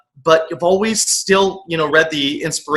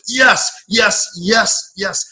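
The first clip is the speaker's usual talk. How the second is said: inner cheering; 6 semitones higher, much slower than usual. Retold or imitated. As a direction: pump fist silently, yes yes yes. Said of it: "on the inside, I was like".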